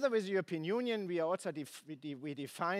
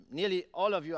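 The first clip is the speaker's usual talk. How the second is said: ordinary delivery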